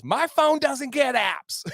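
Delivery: high, drawn-out exasperated protest; mock going off. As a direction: imitate an irate passenger complaining. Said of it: "kinda going off".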